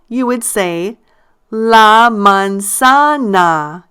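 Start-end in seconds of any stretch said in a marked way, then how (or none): 1.5-3.8 "la manzana" very slowly, stress on ZA; stressed syllable. Shown as a demonstration. Teacher asking learners to say it with her, slow stressed "ZA" in "manzana". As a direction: break "la manzana" into drawn-out syllables, leaning on ZA. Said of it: "notice I stress the syllable".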